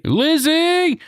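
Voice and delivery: very high, stretched-out name call; mock entrance call. Host announcing Lizzie Rain's entrance, high drawn-out call.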